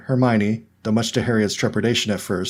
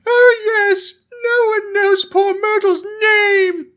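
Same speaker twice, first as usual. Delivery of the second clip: wailing ghost; 6 dB louder, much slower than usual. A narrator's reading. Ghost wails at the girls.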